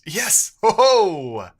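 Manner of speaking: loud, high whoop of victory; triumphant yes. Real, not acted. Coder cheers as his query works. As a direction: celebrate the fix out loud.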